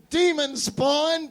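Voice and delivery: slow, high, drawn-out accusing yell. Acted. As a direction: point and cry out at the demon.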